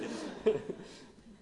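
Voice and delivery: a burst of high, breathy laughter. Real, not acted. Pastor laughing about her daughter, a high airy laugh. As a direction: laugh out at the memory.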